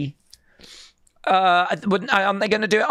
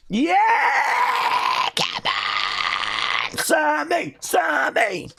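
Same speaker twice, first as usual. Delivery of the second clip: ecstatic celebration; 10 semitones higher, much slower than usual. Real, not acted. Wrestling fan screaming for Sammy's title win, ecstatic. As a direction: yell it like your guy just won the belt.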